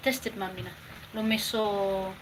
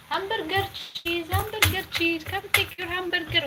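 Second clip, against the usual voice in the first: imitating cartoon line; 8 semitones higher than usual. Mother quoting the cartoon her son loved, high sing-song imitation. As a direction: call it out like the Garfield character. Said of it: "when he was speaking like that with the dog".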